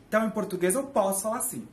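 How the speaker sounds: ordinary talk, nothing marked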